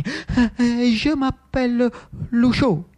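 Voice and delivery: high, halting, slow answer; timid young man. Acted. Lucio introducing himself to the Jacobin, timid and halting. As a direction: stammer out your name timidly.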